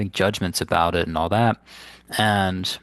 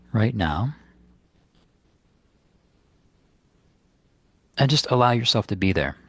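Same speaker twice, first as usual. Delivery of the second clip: unhurried phrases with long, restful pauses; guided meditation; 2 semitones higher than usual. Acted. Meditation teacher guiding listeners to ground the body, in guided meditation style. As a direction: guide them gently inward, leaving space between instructions.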